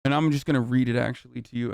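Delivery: ordinary talk, nothing marked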